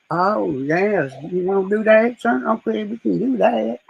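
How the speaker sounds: drawn-out dumb act; playing dumb. Man fooling someone who underestimates him, dumb act. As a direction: play the agreeable simpleton, stretching the last words. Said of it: "putting on this dumb look in my face".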